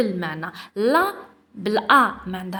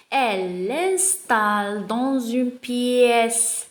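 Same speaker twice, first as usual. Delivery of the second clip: slow reading aloud; much slower than usual. Shown as a demonstration. Teacher reading the text aloud to pupils, very slow. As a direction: read each word very slowly. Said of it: "On va commencer".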